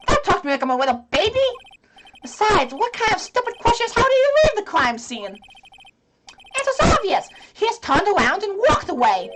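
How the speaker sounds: put-on high, loud indignant kid; annoyed kid. Child witness scoffing at the lawyer, indignant. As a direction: huff it out like an offended kid.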